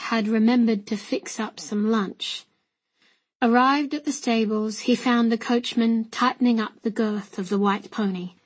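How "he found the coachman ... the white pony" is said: ordinary delivery, nothing marked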